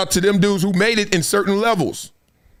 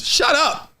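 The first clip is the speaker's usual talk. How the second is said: a sharp, higher, blunt shut up; 7 semitones higher than usual. Shown as a demonstration. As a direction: cut them off bluntly. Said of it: "I've told people to shut up".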